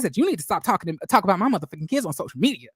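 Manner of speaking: fast, rushed angry rant; fast talking. Retold or imitated. Melody rants at someone fast about her kids on social media. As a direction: rattle it off in one breath. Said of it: "talking real fast".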